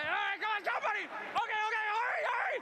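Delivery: high, eager calling out; urging excitedly. Real, not acted. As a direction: hurry the crowd along. Host urging crowd.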